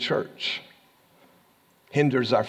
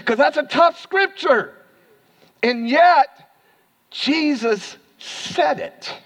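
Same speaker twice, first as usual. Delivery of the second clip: emphatic preaching; 12 semitones higher, 4 dB louder, slower than usual. Real, not acted.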